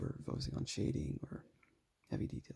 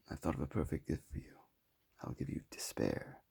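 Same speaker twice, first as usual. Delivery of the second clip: menacing villain quote; 10 semitones lower than usual. Retold or imitated. Reading a friend's favourite movie line, low and menacing. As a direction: intone it like a dark movie villain.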